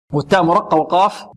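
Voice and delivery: ordinary talk, nothing marked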